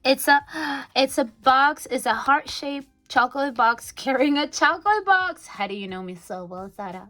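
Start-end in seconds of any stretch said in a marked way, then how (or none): 0.1-5.4 higher, louder, thrilled; delighted surprise at chocolate; excited discovery. Real, not acted. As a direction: gasp and gush at the box.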